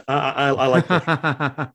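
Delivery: laughing through the words, slow. Real, not acted. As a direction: chuckle while admitting you like pressure. Laughs at the pressure question.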